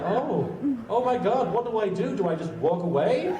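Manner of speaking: a flustered inner panic, hammed up; mock panic. Guest stuck beside awkward fans, mock panic. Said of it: "and I'm like".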